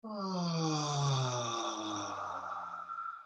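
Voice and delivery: long, breathy falling sigh; long releasing sigh. Shown as a demonstration. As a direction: let it all go in one slow sigh. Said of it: "Pull down the heavens with a sigh".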